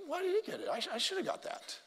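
mock the envious voice inside us; imitating a jealous churchgoer's grumbling, high and soft; jealous whine. Mocking jealous inner voice.

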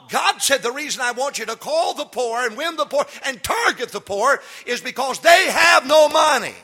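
loud, high preaching at full force. Acted. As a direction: preach it out to the congregation.

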